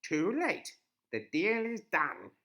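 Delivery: loud, slow witch; cold old witch. A narrator's reading. Witch voiced.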